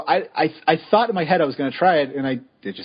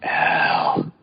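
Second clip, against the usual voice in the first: low, drawn-out mock call; low pretend summons; 9 semitones lower than usual. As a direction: playfully call for someone who isn't there, low. Calling to absent Al.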